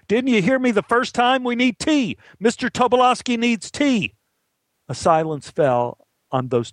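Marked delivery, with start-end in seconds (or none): 0.1-4.1 high-pitched, scolding yell; yelling at him. Acted. Assistant director to the boy who brings tea, scolding yell. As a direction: berate him from across the set. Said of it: "the A D yelled at him".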